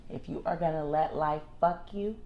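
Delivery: usual speaking voice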